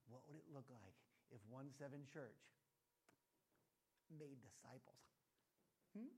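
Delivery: a dreamy hushed what-if; mock musing. Mocking a church study group, soft musing.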